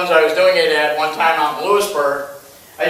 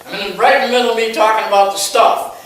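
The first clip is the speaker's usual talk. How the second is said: ordinary talk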